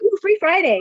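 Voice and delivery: chirpy joke to the barista; playful joke. Retold or imitated. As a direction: sing it out like a little joke. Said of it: "I'm like".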